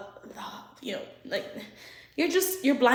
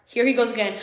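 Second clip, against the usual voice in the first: ordinary delivery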